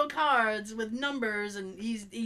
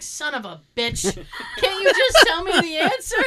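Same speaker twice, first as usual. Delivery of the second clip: frustrated outburst; 6 semitones higher, 9 dB louder than usual. Retold or imitated. Child's frustration voiced.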